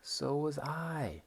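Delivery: "So was I" high, slow, climbing up; rising "So was I". Shown as a demonstration. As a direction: lift "So was I" upward at the end. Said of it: "It really goes up".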